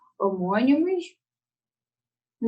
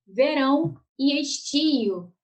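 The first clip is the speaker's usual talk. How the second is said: slow reading; 5 semitones higher, much slower than usual. Shown as a demonstration. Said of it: "reading the pair slowly".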